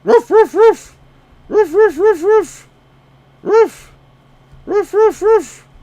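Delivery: loud, very high barks, yapping like a sheepdog; shrill barking yaps; dog barking. Pretend.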